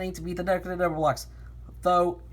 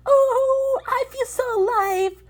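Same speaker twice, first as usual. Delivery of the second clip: high character voice; 8 dB louder, slower than usual. Acted. Character voice in read story.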